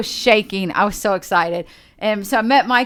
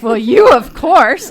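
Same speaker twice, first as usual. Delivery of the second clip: loud, slow gushing answer; mock-adoring gush to the friend; gushing reply; 4 semitones higher, 10 dB louder, slower than usual.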